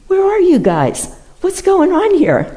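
high, loud mock yell of panic; yelling at the masters. Mid-sermon to the masters behind him, a loud mock yell. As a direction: call out to the unseen masters, half-laughing in alarm. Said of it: "I start yelling at the masters".

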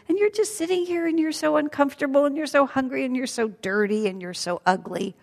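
high, fast, jeering demon women; taunting demonesses. Acted. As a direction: cackle the insults fast and high.

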